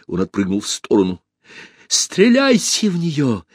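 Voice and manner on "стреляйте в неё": high, drawn-out frantic command; shouted order. A narrator's reading. Usurper ordering bodyguards to fire, shouting high. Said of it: "крикнул он телохранителям".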